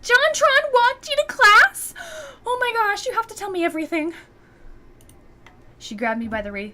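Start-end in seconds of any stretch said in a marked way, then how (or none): 0.0-4.1 high, loud, stretched squeal; pressurized squeal. Acted. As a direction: squeal it out like a starstruck fan. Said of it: "Mai emitted a highly pressurized squeal".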